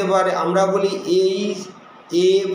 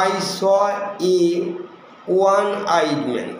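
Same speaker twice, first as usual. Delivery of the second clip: slow example sentence; much slower than usual. Shown as a demonstration. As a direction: slowly read English example. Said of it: "one". (ধীরে ধীরে উদাহরণ পড়া)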